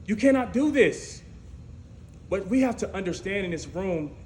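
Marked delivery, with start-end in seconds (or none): 0.1-1.2 higher, louder insistent no; louder refusal. Retold or imitated. Doubters shutting David down, louder. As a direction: shut him down hard. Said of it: "they said".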